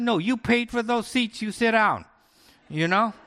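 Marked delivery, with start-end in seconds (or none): 0.0-2.0 firm. Retold or imitated. Agent firmly refusing rude couple.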